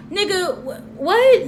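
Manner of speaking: rising into a shocked squeal; incredulous what. Retold or imitated. Vlogger reacting to A one blaming Jason Lee, incredulous. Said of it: "And I'm like".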